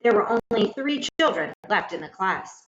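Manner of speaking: ordinary delivery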